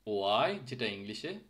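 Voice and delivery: usual speaking voice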